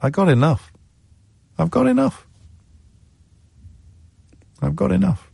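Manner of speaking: a touch of high calm; serene therapist. Retold or imitated. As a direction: savour the words, lightly voiced therapist. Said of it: "And she says".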